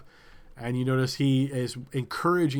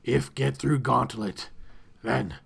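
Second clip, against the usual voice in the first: slow, gloating creature voice; lightly voiced creature leader, gloating; masked creature leader; 4 dB louder, slower than usual. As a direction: savour the offer of a deadly game. Masked leader explains gauntlet.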